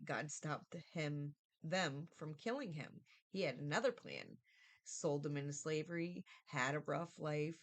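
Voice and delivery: ordinary talk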